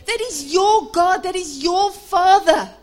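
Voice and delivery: high, loud rallying shout; impassioned pulpit shouting; preach at full force. Real, not acted. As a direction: fire up the congregation at full volume.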